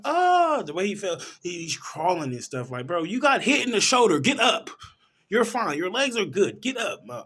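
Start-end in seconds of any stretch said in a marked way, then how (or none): 0.0-0.6 pained cry. Retold or imitated. Victim speared through chest. 2.9-7.2 loud, high urging shout; yelling at the screen. Pretend. Viewer shouting at the wounded guy on screen, loud and high.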